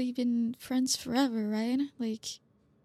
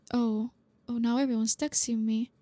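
normal talking voice

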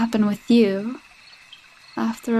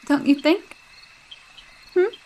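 ordinary talk

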